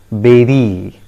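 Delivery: "beri" as BEH-ree, falling; correct pronunciation. Shown as a demonstration. Teacher having learners repeat, "beri" as BEH-ree. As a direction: say "beri" with an eh vowel. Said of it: "It is pronounced as".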